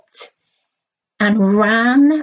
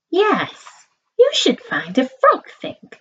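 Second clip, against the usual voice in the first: higher mother voice, chiming in; mum agreeing; 8 semitones higher than usual. Acted. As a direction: back the dad up as a bright mum. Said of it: "said Mum".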